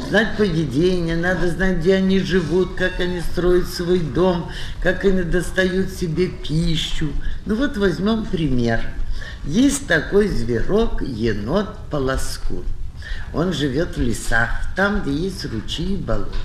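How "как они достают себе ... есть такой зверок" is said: normal talking voice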